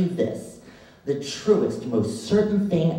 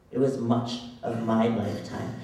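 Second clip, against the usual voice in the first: normal talking voice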